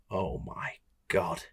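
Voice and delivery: slow, low and hushed; retold horrified realisation. Realising who is on the phone, hushed mock horror. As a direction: let it sink in slowly, very softly.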